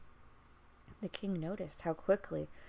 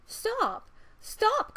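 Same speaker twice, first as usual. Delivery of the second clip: much higher, louder cry; frog crying out; 10 dB louder than usual. Acted. Frog crying after the fleeing princess, high and loud. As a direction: shout after her desperately. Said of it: "cried the frog".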